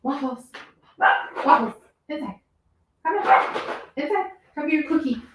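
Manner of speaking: high coaxing call to a pet; calling a dog. Real, not acted. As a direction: coax the dog inside.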